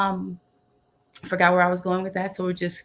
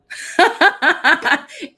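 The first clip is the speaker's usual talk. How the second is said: short high real laugh; bright laugh; 10 semitones higher, 7 dB louder than usual. Real, not acted. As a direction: let a happy laugh burst out. Laughs after her summary.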